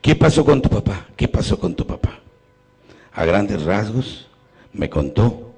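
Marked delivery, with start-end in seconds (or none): 1.1-2.2 whispered repeat. Retold or imitated. Priest gently pressing the dying woman about her father, whispered.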